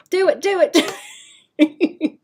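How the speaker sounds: high, loud chant of encouragement; egging on. Real, not acted. Streamer egging chat on to try accent. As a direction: hype chat up to do it.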